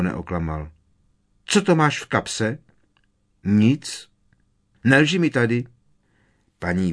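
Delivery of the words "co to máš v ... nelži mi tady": higher, louder scolding of a son; mother scolds George over his pocket; stern mother. A narrator's reading. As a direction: nail the fibber, sternly.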